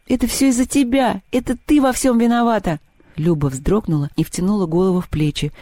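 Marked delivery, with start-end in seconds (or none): 0.1-2.8 high accusing yell; hysterical blaming. A narrator's reading. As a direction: lash out at Люба. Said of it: "принялась кричать на Любу". (Маша кричит на Любу)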